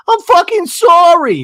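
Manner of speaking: high, loud mock cry; hammed-up yelled sorry; retold exasperated apology. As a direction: blurt it out, drawn out. Acted outburst in quarrel.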